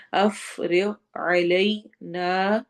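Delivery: افرغ علینا stretched very slowly, the final نا lengthened two counts; tajweed demo. Shown as a demonstration. Teacher slowly recites افرغ علینا for students to copy. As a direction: draw out افرغ علینا, holding the نا. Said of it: "دو حرکہ ہم نے لمبا کرنا ہے".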